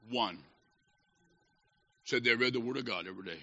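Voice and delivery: very low and soft; grave hush. Real, not acted. Preacher reveals one of sixty-two reads the Word, in a low hush. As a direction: drop far down to reveal a sad result.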